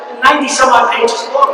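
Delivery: ordinary talk, nothing marked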